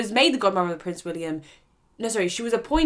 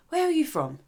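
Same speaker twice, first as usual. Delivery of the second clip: a lightly voiced prying lady, pitched up; a hint of a nosy aristocrat, voiced higher; the lady asking; 9 semitones higher than usual. Host voicing the lady questioning the visitor, lightly mimicked.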